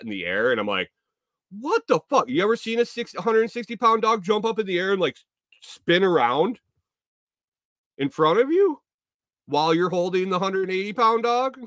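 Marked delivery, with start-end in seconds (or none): 1.5-11.6 much higher, disbelieving rant; incredulous outburst. Retold or imitated. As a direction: pitch it way up in disbelief. Retold disbelief at dog pirouette.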